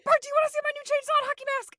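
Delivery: very high, loud and rushed; high fast mock Homer. Retelling the show, Homer bursting in again, high and fast. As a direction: gush it fast like a creepy dad. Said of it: "he's like".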